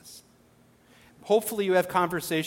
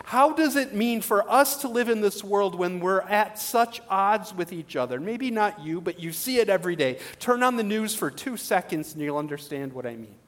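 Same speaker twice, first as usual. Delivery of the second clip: usual speaking voice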